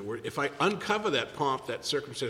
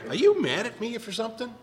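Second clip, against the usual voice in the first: higher, lightly voiced friend; Jason's question; 9 semitones higher than usual. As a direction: ask like a worried friend, pitched up.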